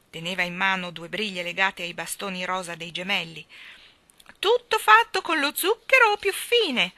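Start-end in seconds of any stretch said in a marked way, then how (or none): 4.4-6.9 very high, slow, loud vendor's cry; Miss Calicò. Acted. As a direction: stretch the sales cry to carry into the sky. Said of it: "La voce della signorina Calicò giungeva lassù".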